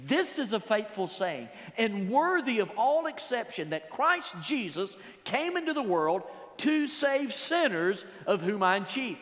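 high, loud, rising preacher's cry; preaching at full force. Acted. Preacher driving home sin to his congregation, preaching hard. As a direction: preach it hard, drawing out the words.

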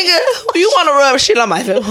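high, laughing and incredulous; can't believe it, laughing; laughing disbelief. Real, not acted.

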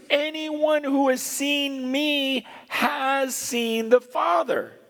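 high, slow, insistent proclamation; emphatic declaration. Retold or imitated. Jesus telling Philip who he really is, emphatic.

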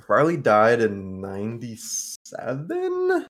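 slow, drawn-out questioning guess; unsure recall. Real, not acted. Podcast host answering when Farley died, unsure and drawn-out. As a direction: stretch the year out as you search your memory.

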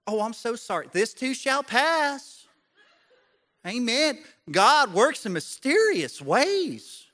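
high, slow mock-pious sing-song; churchy. Imitating people-pleasers among church friends, hammed-up churchy. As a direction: stretch it out like over-pious church folk. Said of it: "they wanna sound churchy".

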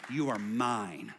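low and slow, gently spoken; tender quote. Acted. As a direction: say it like a promise. Quoting God's tender words.

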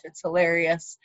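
ordinary talk, nothing marked

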